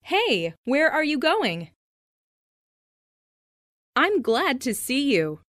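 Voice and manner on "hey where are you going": ordinary talk, nothing marked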